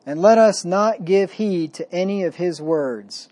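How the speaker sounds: scripture reading. Acted. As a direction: read it like holy writ, weighty and measured. Reads scripture aloud.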